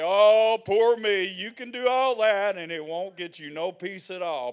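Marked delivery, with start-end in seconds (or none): 0.0-1.2 louder, whiny mock self-pity. Preacher mocking grumblers to congregation, whiny mock self-pity. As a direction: ham up a sorry-for-myself moan. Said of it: "You can grump and gripe and say".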